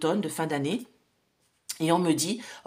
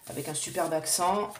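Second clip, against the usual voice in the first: ordinary talk, nothing marked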